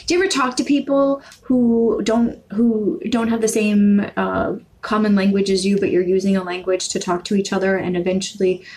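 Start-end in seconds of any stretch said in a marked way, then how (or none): none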